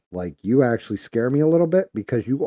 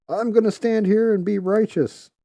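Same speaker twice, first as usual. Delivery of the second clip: much higher, mocking a self-important activist; mock righteous; 13 semitones higher, 5 dB louder than usual. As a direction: parody someone puffed up with pride.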